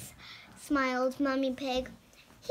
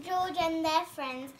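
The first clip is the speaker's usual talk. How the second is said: usual speaking voice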